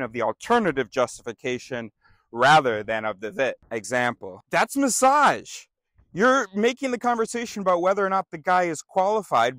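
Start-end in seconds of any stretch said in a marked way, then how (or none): 4.5-9.6 high, loud accusation, first words stretched; calling out. Acted. Calling out an opponent's dodge in an argument, loud accusation. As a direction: pounce loudly on a faulty argument.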